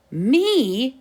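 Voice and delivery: high indignant exclaim. A narrator's reading. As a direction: blurt it out in disbelief. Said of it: "exclaimed Mark".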